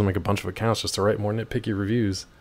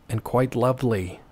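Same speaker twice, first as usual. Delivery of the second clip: ordinary talk, nothing marked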